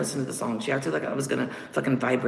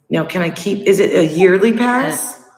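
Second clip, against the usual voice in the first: excited; 4 semitones higher, 9 dB louder than usual. Real, not acted. Joking loud question.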